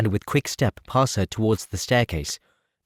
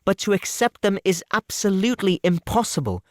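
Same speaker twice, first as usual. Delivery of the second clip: put-on young woman's voice, higher, earnest and resolute; a heroine turning down a suitor, higher and firm; Elizabeth, firm refusal; 9 semitones higher than usual. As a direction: refuse him politely but without budging. Elizabeth refusing Mr Collins' proposal.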